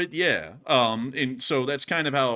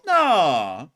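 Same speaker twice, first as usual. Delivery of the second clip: loud, higher drawn-out denial; mock reassuring denial; 5 semitones higher, 8 dB louder than usual. Husband answering his wife's question, exaggerated mock denial.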